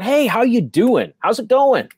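high, slow, welcoming hello; friendly greeting. Shown as a demonstration. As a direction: greet the customer like you're glad they came. Modelling a friendly staff greeting.

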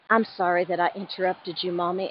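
normal talking voice

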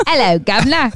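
a mock British "governor" greeting; shitty British accent. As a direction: tip the hat with a bad British accent. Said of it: "you do shitty accents".

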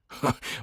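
short scoffing chuckle; a brief dismissive huff of laughter; scornful laugh. A narrator's reading. Travis mocking the Eskimo belief, scornful laugh.

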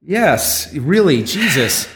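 usual speaking voice

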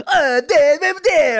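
high, loud mock Italian babble; hammed-up Italian singsong; overdone Italian imitation. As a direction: overdo the Italian sounds for laughs. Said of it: "then you overdo it".

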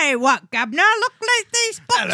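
loud, hammed-up fake Cockney; awful Cockney accent. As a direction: do a terrible stage Cockney mover. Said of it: "awful Cockney accents".

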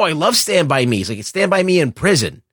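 usual speaking voice

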